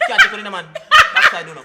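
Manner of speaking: words breaking into high laughter; laughing. Real, not acted. Cracking up at comment.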